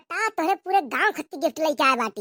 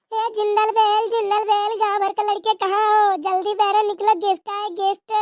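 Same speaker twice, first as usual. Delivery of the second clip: higher, louder Santa call across the village; Santa hollering; 6 semitones higher, 3 dB louder than usual. Acted. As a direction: sing out the jingle call to the whole village. Santa calls again, louder. (ऊँची, ज़ोरदार आवाज़ में गाँव भर को पुकारता Santa)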